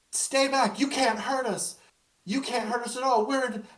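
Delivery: put-on high, loud child facing a monster; a girl shouting at the creature to stay back; defiant child shout. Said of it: "you hear Rose go".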